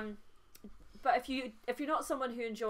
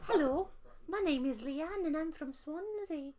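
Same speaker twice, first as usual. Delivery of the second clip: lilting, higher Welsh introduction; Welsh accent; 5 semitones higher than usual. Shown as a demonstration. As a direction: introduce yourself in put-on Welsh. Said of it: "I could do a Welsh accent".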